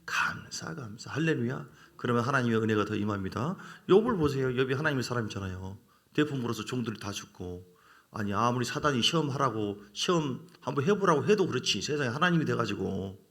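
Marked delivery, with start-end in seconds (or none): none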